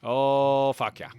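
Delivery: Mainer's laid-back drawl; Maine accent. Pretend. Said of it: "I'm trying to talk like a Mainer".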